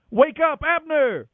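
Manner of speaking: high, loud shout across the valley; shouted call. A narrator's reading. David shouting from the hilltop down to Abner, high and loud. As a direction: call out hard to wake the sleeping camp. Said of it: "Then he shouted down to Abner and Saul".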